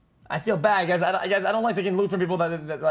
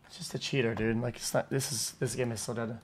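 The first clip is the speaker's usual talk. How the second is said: deflated; 7 semitones lower, 13 dB quieter than usual. Real, not acted. Disappointed after a cheater kill.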